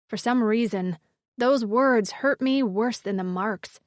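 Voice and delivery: plain reading voice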